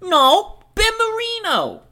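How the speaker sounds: very high, squeaky stage voice; sky-high put-on pitch; [name]'s character voice. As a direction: answer in a piping character voice. [name] answers in scene.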